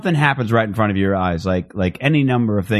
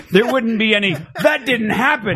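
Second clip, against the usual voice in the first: much higher, scoffing denial; mock dismissal; 12 semitones higher than usual. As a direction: wave it away in disbelief. Mock dismissive reply from Teddy.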